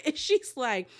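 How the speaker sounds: usual speaking voice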